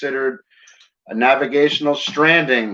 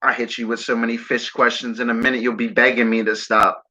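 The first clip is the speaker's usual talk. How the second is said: normal talking voice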